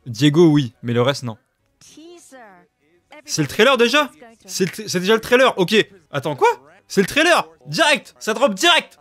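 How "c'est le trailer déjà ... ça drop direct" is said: high, loud, rushing disbelief at the trailer; excited. Real, not acted. Streamer yelling to chat as the trailer drops, excited. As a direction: voice jumps up as the trailer starts.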